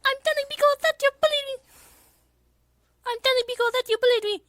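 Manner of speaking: put-on very high, fast whiny girl voice; Miku voice. Miku tattling to Pico, very high and fast. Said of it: "It's Miku".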